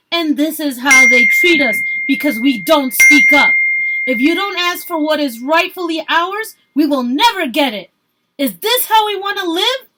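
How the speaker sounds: high, loud rallying cry; angry organizer shouting from a truck; yelling speech. A narrator's reading. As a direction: rouse the crowd, full voice. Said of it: "she yelled".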